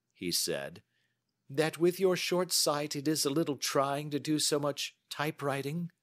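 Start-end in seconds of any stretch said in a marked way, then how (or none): 1.5-5.9 higher-pitched, polite probing voice; courteous detective questioning. Acted. Holmes questions his short-sighted client, courteously probing. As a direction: put the observation as a polite question.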